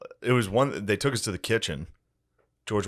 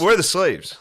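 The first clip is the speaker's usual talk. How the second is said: higher and louder; mock loud demand; 10 semitones higher, 8 dB louder than usual. Demanding to see the slaves, mock loud. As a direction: blurt the question out. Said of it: "I was like".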